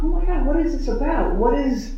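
lightly laughing in disbelief; laughing amazement. Retold or imitated. Laughing at herself in her friend's arms, lightly amused. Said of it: "And then I was laughing".

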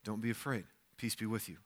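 a hushed, calming Jesus; soft, gentle. Retold or imitated. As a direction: soothe frightened disciples. Jesus speaking to disciples.

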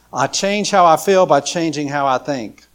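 preacher announcing point three to the congregation, proclaimed loudly; emphatic proclamation. Shown as a demonstration. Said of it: "repeats the key line".

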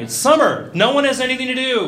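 put-on whiny kid complaint. Kid complaining to mom about early swim practice, whining loudly. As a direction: complain like a tired kid dragged out of bed.

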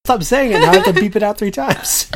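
high and loud through laughter; laughing protest. Real, not acted. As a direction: laugh while pleading.

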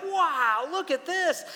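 put-on rising, amazed kids' wow; kids in awe. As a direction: gasp it out like a kid seeing mountains. Kids seeing mountains first time.

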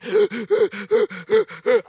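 high, loud panting gasps; struggling gasps. Retold or imitated. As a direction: act out desperate gasping for air. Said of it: "I'm just struggle busing".

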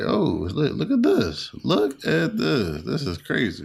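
high-pitched retold amazement; amazed reaction. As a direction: relive gawking at something unbelievable. Retelling his amazed reaction to footage.

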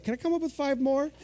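high-pitched, playfully hopeful question; mock hopeful asking. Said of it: "he was just hoping for five more".

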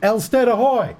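high hail across the water; shouting. A narrator's reading.

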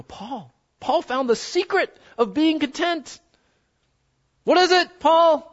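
high, eager voicing of the crowd calling out; mock congregation voice. Pastor voicing the crowd pestering Paul, high and eager.

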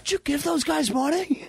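high-pitched, astonished retelling; mock incredulous uncle. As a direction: squeak the question out like a stunned uncle. Acting out Uncle Paul's reaction.

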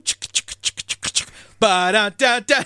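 whispered shaka shaka rhythm; shaka beat imitation. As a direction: tap the rhythm out in breathy syllables. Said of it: "it's just going".

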